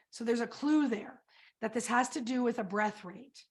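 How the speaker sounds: ordinary talk, nothing marked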